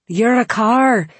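high, loud, rolled R's; Scottish accent. Retold or imitated. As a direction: roll the R in car, Scottish. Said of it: "his R's rolling in that Scottish accent".

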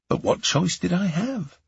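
ordinary delivery, nothing marked

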